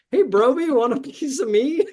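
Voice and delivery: high, loud playful challenge; quoted show line. Acted. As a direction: deliver a kids' show catchphrase, pitched well up. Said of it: "one of my favorite lines".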